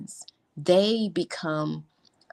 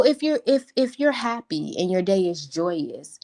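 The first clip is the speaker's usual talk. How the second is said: usual speaking voice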